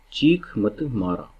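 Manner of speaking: चीख मत मारा drawn out slowly, word by word; slow deliberate चीख मत मारा; slow sound-alike demo. Shown as a demonstration. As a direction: spell out चीख मत मारा slowly so it echoes chinkara. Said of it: "आप बोलेंगे इस शब्द को चिंकारा".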